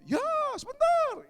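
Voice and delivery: put-on very high, loud kid's reply; imitated drawn-out childish yell; child shouting back. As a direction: holler back from another room. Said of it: "Biasanya jawab".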